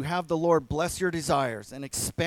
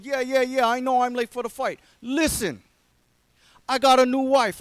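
higher, louder Philadelphia tough-guy plea; hammed-up Rocky asking for a blessing; Rocky pleading; 5 semitones higher, 6 dB louder than usual. Rocky voiced pleading with priest.

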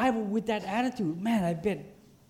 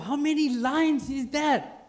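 high, slow, incredulous question; baffled exclamation; 7 semitones higher, 4 dB louder, slower than usual. Retold or imitated. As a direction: stretch it out in loud bafflement. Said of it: "I thought".

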